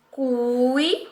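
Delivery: "cui" held long in one breath, rising at the end; single held syllable. Shown as a demonstration. Teacher showing one emission of voice, "cui" held as one syllable. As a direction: stretch "cui" as one unit. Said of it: "pronunciada em uma única emissão de voz".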